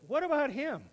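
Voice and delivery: high, sudden, nosy question; Peter's nosy question. Acted. Peter pointing at John, high nosy voice. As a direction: blurt it out jealously.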